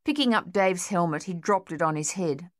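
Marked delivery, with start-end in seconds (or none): none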